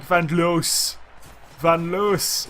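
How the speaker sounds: "Van Loos" with a long oo; attempted pronunciation. Shown as a demonstration. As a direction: try out "Van Loos" slowly, stretching the vowel. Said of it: "Is that how you say it?".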